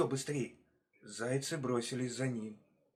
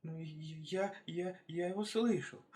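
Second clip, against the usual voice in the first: high, stuttering little hare; timid, halting high voice; frightened stammer; 6 semitones higher than usual. Acted. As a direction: stammer and trip over each first word.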